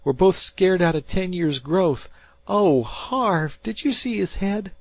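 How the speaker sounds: put-on high young boy's voice, scared; a boy spooked by a corpse in the fog; frightened fisher boy. A narrator's reading. Dan to Harvey, scared boy voice.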